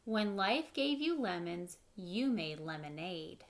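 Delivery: plain reading voice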